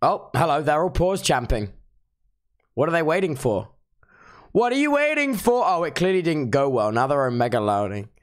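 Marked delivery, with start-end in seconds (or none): 4.5-5.6 high-pitched goading question; exclaimed challenge to the chat; urging exclamation. Pretend. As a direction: pitch it way up, egging them on. Urging on the chatters.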